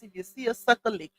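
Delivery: softly tossed-off two K imitation. Streamer quoting two K in a sucker aside, lightly imitated. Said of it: "In my two K voice".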